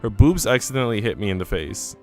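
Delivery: ordinary delivery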